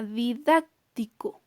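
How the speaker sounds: didáctico as di-DÁC... tico, pause after DÁC; didáctico broken after its stressed DÁC; stressed syllable marked. Shown as a demonstration. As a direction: say didáctico with weight on DÁC, then a break. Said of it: "una mayor fuerza de voz en la antepenúltima sílaba".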